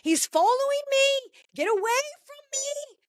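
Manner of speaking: high-pitched panicked New Zealander caricature; hammed-up New Zealand accent, pleading in a high voice; mock New Zealand accent. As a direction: squeal in fake fear with a put-on New Zealand accent. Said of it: "he's got the accent".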